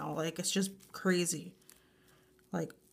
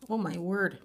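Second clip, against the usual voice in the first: usual speaking voice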